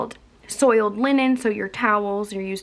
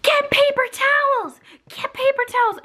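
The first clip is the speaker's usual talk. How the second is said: high mock yell imitating a bossy proctor; a bossy proctor's shrill-pitched mock order; imitating a rude proctor. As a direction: mimic her snapping orders, pitched way up. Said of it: "this woman's like".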